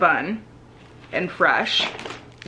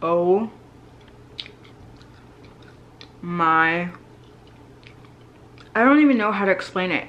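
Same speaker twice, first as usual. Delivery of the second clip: speaking with food in mouth; talking between bites; chewing while talking. Real, not acted. Seen 0: Bites pastry at -4.0, chews visibly through speech until +7.1.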